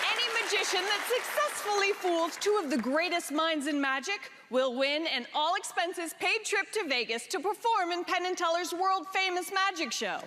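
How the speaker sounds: booming prize announcer. Acted. TV show host introduces contest and prize.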